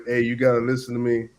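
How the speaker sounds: ordinary delivery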